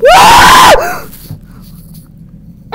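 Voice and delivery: piercing high scream, very loud; terrified shriek. Real, not acted. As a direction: let out a shrill scream of fright. Jump scare.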